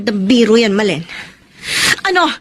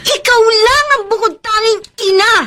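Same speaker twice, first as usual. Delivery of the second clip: furious shout; 8 semitones higher than usual. Acted. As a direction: scream your outrage at her. Mother confronts the kidnapper mother. (galit na galit na sigaw)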